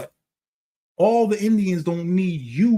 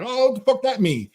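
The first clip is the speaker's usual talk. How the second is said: confused reaction; 7 semitones higher than usual. Retold or imitated. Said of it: "And you're like".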